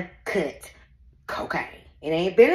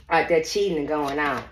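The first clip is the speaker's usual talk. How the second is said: ordinary delivery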